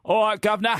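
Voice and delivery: high Cockney "all right, governor"; chirpy Cockney greeting; Cockney accent. Acted. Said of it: "you can be the Cockney astronaut".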